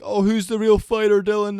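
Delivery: loud, high-pitched mock scream; imitated screaming. Imitating Logan Paul taunting Dylan on the mic, screaming. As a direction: yell it out, mimicking a boxer's taunt. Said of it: "he starts screaming".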